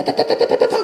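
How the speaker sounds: rapid high ta-ta-ta gunfire; spray the room like a yakuza's machine gun; mock machine gun. Mimicking a yakuza's gun in a shabby restaurant, playful rat-a-tat.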